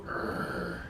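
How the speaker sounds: low, dull err sound. Shown as a demonstration. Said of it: "we're very tempted to go".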